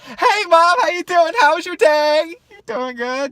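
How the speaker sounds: high, loud, sugary innocence; butter her up, way up high; overly sweet kid. Retold or imitated. Guilty son greeting angry mom off bus, sugary high.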